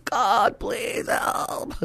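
strained, croaking plea; hoarse prayer. Retold or imitated. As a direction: voice giving out into a raspy croak. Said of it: "my voice was getting hoarse".

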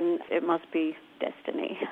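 a hushed mock-grand whisper. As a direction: breathe it like a secret. Mock-dramatic aside about her fame.

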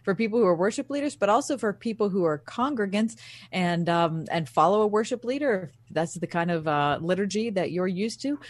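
upbeat radio announcer read; radio host read. Acted. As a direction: tease the next segment like a host. Radio segment tease.

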